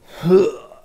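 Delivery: a loud, imitated gag and heave; mock retching. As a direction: fake the sound of throwing up at the poker table. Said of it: "And just throws up on the table".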